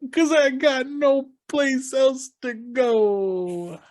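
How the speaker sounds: very high, very slow strained plea; Chris Farley line. Retold or imitated. Podcast host imitating Farley's famous line, high slow whine. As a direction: stretch it out in a pathetic high whine. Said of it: "the famous line Chris Farley goes".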